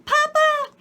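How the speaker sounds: put-on very high, loud child voice; little girl's voice. A narrator's reading. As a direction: squeal like a small girl. Child voice again.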